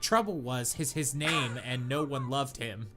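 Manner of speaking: usual speaking voice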